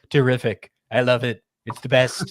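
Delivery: lightly voiced impression of a pleased, gormless leader; Donald Trump. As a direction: do a light parody of a dim, self-satisfied president. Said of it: "That's a great impression".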